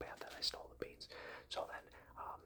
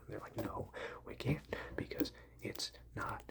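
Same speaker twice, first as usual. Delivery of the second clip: breathy close-mic whisper telling a silly tale; ASMR whisper; 7 dB louder than usual. Real, not acted. Telling listeners a rambling bean-shopping story, ASMR whisper.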